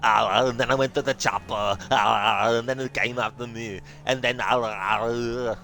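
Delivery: over-the-top Austrian Arnie accent, lifted and punchy; exaggerated Arnie. Shown as a demonstration. Gamer demoing an exaggerated Austrian Arnie accent. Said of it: "you can do the exaggerated version".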